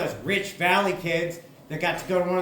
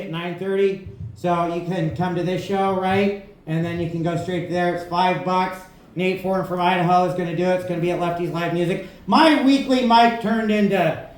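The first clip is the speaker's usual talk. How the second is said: ordinary delivery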